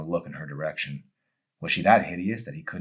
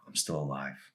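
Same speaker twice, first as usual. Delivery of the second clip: much softer, lower self-reassurance; a hushed hint of relief; quiet relief; 3 semitones lower, 8 dB quieter than usual. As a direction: barely breathe the words to herself. Emery checking pulse.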